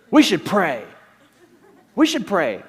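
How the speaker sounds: put-on high, loud child's plea; eager young son. Son urging Dad to pray, eager and high-pitched. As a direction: pipe up urgently like a little boy.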